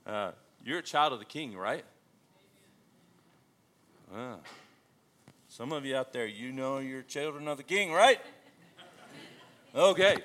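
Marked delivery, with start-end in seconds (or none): none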